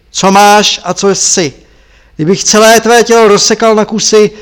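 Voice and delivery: enraged master berating the thief; furious shout. Acted. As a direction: shout the accusations down at him.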